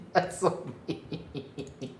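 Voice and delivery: can barely speak from laughing; breathless laugh. Real, not acted. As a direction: wheeze it through laughter. Laughing through it.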